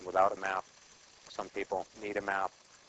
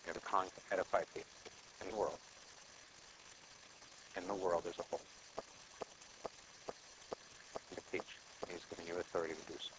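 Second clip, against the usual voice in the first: softer, intimate ministering voice; hushed prayerful preaching; 12 dB quieter than usual. Acted. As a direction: speak gently, as if praying over them. Soft ministering close of sermon.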